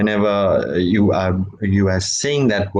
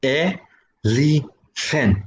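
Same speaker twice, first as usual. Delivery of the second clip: elephant. drawn out slowly, each syllable pulsed; 5 semitones higher, much slower than usual. Shown as a demonstration. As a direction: stretch elephant. into three slow beats. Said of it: "in elephant there are".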